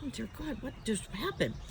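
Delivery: hushed, bewildered inner thought; very soft mock-dismayed mutter; stunned aside. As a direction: say it under your breath, taken aback. Her stunned thought after doctor's alarm.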